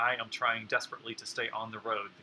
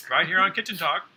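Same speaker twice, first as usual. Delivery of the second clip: show host announcing; 6 semitones higher, 7 dB louder than usual. Pretend.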